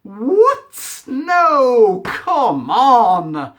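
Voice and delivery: loud, high, indignant What; real fan outrage at the twist; outraged disbelief. Real, not acted. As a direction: reviewer yells at the film's reveal in disbelief. Outraged at Rey's parents reveal.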